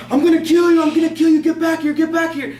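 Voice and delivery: high, loud shouted imitation of a pursuer; furious threats, imitated. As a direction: shout threats like a madman giving chase. Man chases teller, shouting threats.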